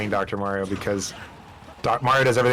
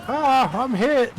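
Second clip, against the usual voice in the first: high-pitched fake cry of being hit; mock wounded cry; 12 semitones higher, much slower than usual. Game pilot crying out as he's shot, high mock wail. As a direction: yelp it like a cartoon casualty.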